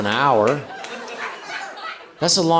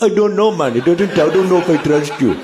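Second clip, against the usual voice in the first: hammed-up Jamaican accent, wary; mock Jamaican accent; 4 semitones higher, 3 dB louder than usual. Jamaican migrant wary of boarding, mock Jamaican accent. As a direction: play a doubtful Jamaican guy. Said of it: "Maybe it's more Jamaican".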